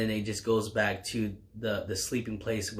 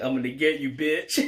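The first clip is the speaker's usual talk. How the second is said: hammed-up threat, a bit higher and louder; mock threat; 4 semitones higher, 4 dB louder than usual. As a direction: ham up the revenge line. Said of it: "and he's like".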